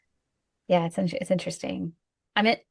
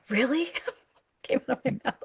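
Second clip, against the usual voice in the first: high, half-laughing disbelief; disbelieving blurt breaking into laughter; incredulous; 6 semitones higher than usual. Retold or imitated. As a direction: let it slip out with a laugh, amazed. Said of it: "I'm gonna be incredulous".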